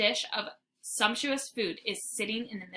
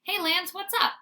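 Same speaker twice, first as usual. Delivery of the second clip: cheery greeting; 9 semitones higher, 4 dB louder than usual. Acted. Dog greets friend.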